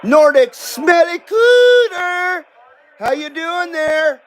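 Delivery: high, drawn-out playful call; hollered greeting. Real, not acted. Streamer greeting a viewer by name, high drawn-out holler.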